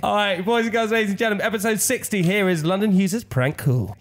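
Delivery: high, upbeat show announcer. Acted. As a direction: introduce the segment like a host. Announces the prank call segment.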